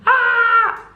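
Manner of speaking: high mock shriek; sudden high-pitched "ah!"; imitated scream. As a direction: mimic kids recoiling in fright. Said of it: "Do the kids not scream?".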